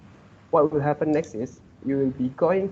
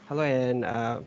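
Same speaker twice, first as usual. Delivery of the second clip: ordinary talk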